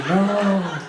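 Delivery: loud, high comic "no"; mock horrified cry. Dancers caught when the light comes on, mock horror. Guests react as light turns on.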